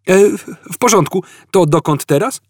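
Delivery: high stammering cover-up; flustered stammer. A narrator's reading. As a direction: stumble then rush on. Arthur flustered, changing subject.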